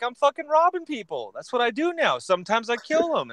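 high-pitched, eager mock catch-up; hammed-up friendly bragging; acted chatty excitement. Robber cheerfully shares his news.

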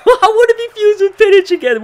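high laughing whine, fusion lost. Real, not acted.

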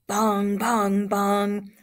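loud, level bongs; ringing fight bell. Acted. Teacher reading the fight's opening bell to students, loud ringing bongs. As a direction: ring it out like a ringside bell.

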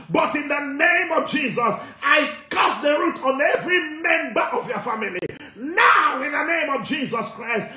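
full-force deliverance prayer, chanting and speaking in tongues; fiery preaching. Acted. Pastor to congregation, commanding deliverance in full preaching voice. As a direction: preach it out, rebuking with fire.

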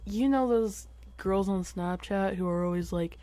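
ordinary talk, nothing marked

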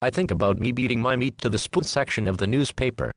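ordinary talk, nothing marked

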